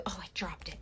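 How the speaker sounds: whispered to herself. Real, not acted. To herself after dropping something, a whispered aside. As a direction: hush it as a private aside.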